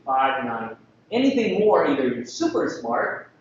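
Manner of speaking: ordinary talk, nothing marked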